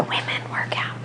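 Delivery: hushed, breathy whisper, as if sharing a secret; whispered aside. Real, not acted. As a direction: drop to a whisper for the punchline. Secretive aside about women's box.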